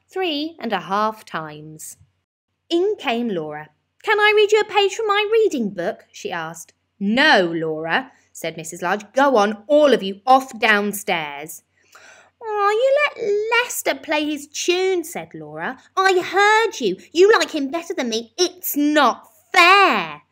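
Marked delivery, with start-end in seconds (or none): 4.0-6.0 put-on very high, loud child's voice; eager little girl. A narrator's reading. Laura asking her mum to hear her reading, high and eager. 7.0-11.6 louder, firm shooing; firm mum. A narrator's reading. Mother sending her children downstairs, loud and firm. 12.4-20.2 very high, loud whine with a cry; sulky child. A narrator's reading. Laura protesting to her mum, high and whiny.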